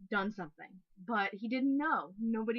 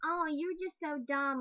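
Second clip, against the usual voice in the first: high, drawn-out mock complaint; mock yelling; 8 semitones higher, 4 dB louder, slower than usual. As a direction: imitate an indignant critic in a raised pitch. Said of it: "if you come yelling at me and going".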